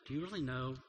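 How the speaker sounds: tender reassurance. Retold or imitated. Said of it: "basically God says".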